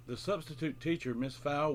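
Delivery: usual speaking voice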